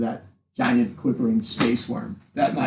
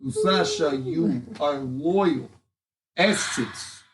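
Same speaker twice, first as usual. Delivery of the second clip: put-on lightly done Russian accent speech; rally the crowd in a Russian accent; 4 semitones higher than usual. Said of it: "I have a Russian accent".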